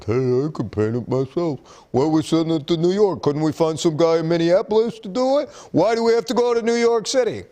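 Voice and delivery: put-on dim-witted complainer, rising in pitch; dumb guy voice. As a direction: whine like a clueless guy, pitch climbing with each question. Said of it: "that's my dumb guy voice".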